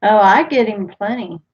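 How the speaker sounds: ordinary talk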